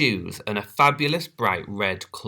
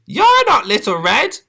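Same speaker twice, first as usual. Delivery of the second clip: high, loud alarmed yell; 14 semitones higher, 9 dB louder than usual. Acted. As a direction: shout the truth. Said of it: "yelled Granny".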